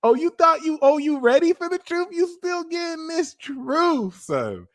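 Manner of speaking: mock them for thinking they were ready; taunting. Taunting someone not ready for the truth, high mocking voice.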